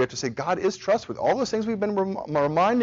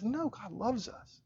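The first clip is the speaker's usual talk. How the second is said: soft, slow, hushed reply; lightly voiced wife, calmly reassuring; wife soothing her tired husband, softly; gentle reassurance; 7 semitones higher, 13 dB quieter, slower than usual. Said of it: "And she says".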